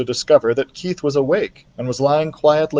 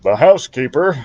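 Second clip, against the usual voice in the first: the wrong character's voice; 2 semitones higher, 4 dB louder than usual. Acted. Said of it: "wrong voice".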